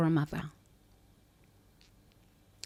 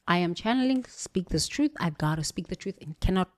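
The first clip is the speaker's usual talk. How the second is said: ordinary talk, nothing marked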